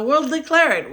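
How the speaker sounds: emphatic declaration. Real, not acted. Telling subscribers they'll find a home, loud and emphatic. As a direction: proclaim it like a triumphant vow. Excited about new house.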